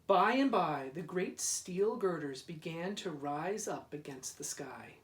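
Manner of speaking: ordinary delivery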